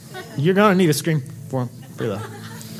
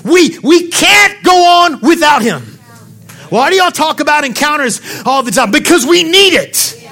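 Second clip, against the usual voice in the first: loud, high-pitched shouting preacher; preaching at full force; 9 semitones higher, 6 dB louder than usual. Real, not acted.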